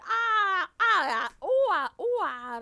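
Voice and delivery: loud high shrieks of outrage; real loud shrieking cries; screaming fit. Real, not acted. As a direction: scream "ah" in disbelief. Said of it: "I said".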